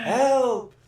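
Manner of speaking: calling out. Acted. Mock cry for help.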